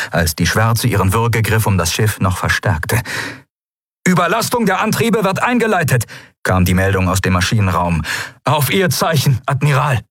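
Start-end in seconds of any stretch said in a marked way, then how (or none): 4.1-6.1 high-pitched engine-room report, held up until the last word; urgent report. Acted. Engine room to the admiral in battle, urgent high-pitched report. 8.4-10.0 raised, higher-pitched crew voice; urgent report. Acted. Engine room awaiting the admiral's final signal, urgent and high-pitched.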